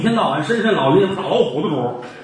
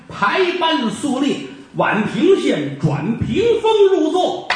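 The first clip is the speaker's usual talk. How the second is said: slow and higher, chanted like a storyteller; slow formal narration; 5 semitones higher, slower than usual. A narrator's reading. Storyteller describing the magistrate taking his seat, slow and formal. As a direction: intone it with ceremony. Storyteller intones court opening.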